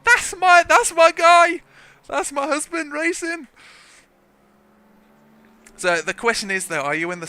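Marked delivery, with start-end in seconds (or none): 0.0-3.5 high, loud excited cheer; cheering wife. Acted. Imagined wife watching her husband race, high excited shouting. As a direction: cheer proudly in a high put-on voice. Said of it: "watch my race and be like".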